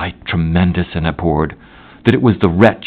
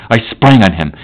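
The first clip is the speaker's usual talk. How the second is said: ordinary delivery, nothing marked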